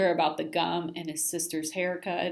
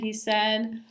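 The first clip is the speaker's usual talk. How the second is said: plain reading voice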